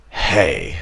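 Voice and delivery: "Hey" with a harsh Russian kh for the H; "Hey" opened on a hard throaty fricative; typical Russian H. Shown as a demonstration. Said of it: "a typical Russian would say".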